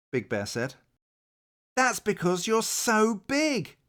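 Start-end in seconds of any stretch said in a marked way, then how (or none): 1.8-3.8 cried out. Acted. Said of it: "cried Little Rabbit".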